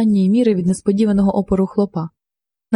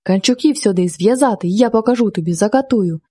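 ordinary delivery

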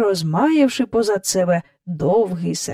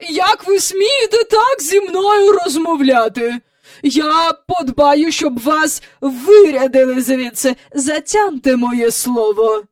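high, loud indignation settling into threat; outraged grande dame; 8 semitones higher, 5 dB louder than usual. A narrator's reading. Visitor threatening the defiant nanny, outraged. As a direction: threaten her imperiously.

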